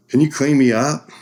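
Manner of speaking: high, loud plea for help; pleading ask. Retold or imitated. Bloody cyclist asking a medic for help, loud plea. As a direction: beg the medic to clean you up. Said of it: "So I'm like".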